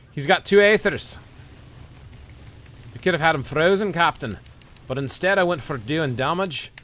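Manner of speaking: high, loud excited commentary. Real, not acted. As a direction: call it out, fired up.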